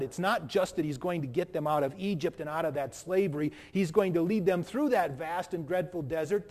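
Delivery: usual speaking voice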